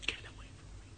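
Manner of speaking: barely voiced whispered refusal; retold whisper. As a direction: breathe it out weakly. Dying man keeps rejecting him.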